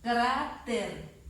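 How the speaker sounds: "cráter" with CRÁ long and strong, falling after; two separate syllables, "cráter" weighted on CRÁ; stressed syllable demo. Shown as a demonstration. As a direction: lean hard on CRÁ in "cráter". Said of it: "dónde está la fuerza de voz".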